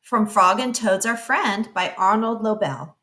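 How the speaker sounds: ordinary delivery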